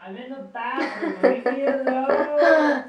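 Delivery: muffled through a door; shouting from the bathroom; from another room. Real, not acted. Calling from the bathroom.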